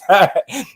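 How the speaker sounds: high burst of laughing. Real, not acted. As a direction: crack up laughing. Laughing at own bit.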